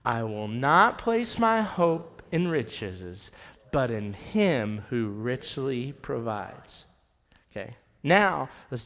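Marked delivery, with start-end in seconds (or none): 0.0-6.8 slow, deliberate, every word spaced; slow congregational recitation. Shown as a demonstration. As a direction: read it together slowly like a creed. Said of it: "Okay, ready?".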